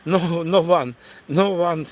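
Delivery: ordinary talk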